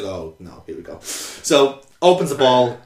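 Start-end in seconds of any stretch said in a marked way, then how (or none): 0.0-1.0 low, soft mock foreboding; resigned dread. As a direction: let it sink to a quiet aside. Acted reluctant reaction to warning.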